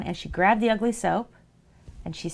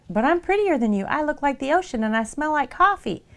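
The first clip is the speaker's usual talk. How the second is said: higher, preening soap voice; pretty soap voice; 7 semitones higher than usual. Acted. Mother voices vain pretty soap boasting to ugly soap, higher. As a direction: brag up high like a vain little soap.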